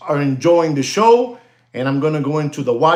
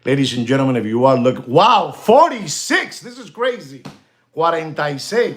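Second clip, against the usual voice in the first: ordinary talk, nothing marked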